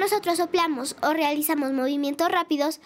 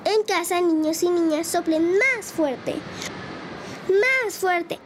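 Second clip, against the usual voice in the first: put-on storytelling to kids. Host reading a story to children at home, lively narration. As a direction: tell the tale to little listeners with gusto.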